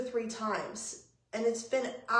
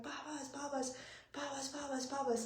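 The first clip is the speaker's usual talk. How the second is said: high, hushed imitation of screaming; mock scream; 4 semitones higher, 11 dB quieter than usual. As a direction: scream in miniature, much softer and higher. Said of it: "screaming, like".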